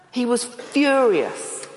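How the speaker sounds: furious drawn out slowly and stressed; He was furious, with a long stressed furious; slow emphatic. Shown as a demonstration. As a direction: lean hard on furious, slow. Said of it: "better to say that Jesus was outraged".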